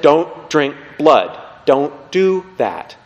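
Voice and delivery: spaced out, word by word; slow, emphatic command. Retold or imitated. Moses forbidding the people to drink blood, slow and emphatic. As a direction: lay down the rule very slowly.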